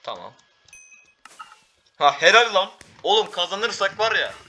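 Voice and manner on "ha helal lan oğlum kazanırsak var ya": high, loud burst of praise; excited cheer. Real, not acted. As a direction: cheer your teammate's great shot. Teammate scores, cheering.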